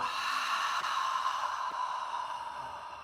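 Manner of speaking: long, soft exhale; drawn-out, airy release of breath; long sigh. Shown as a demonstration. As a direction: sigh it all away, letting go. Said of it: "Sigh it away".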